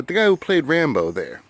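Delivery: usual speaking voice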